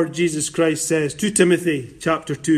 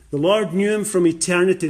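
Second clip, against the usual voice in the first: ordinary talk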